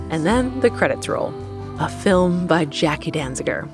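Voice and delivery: ordinary talk, nothing marked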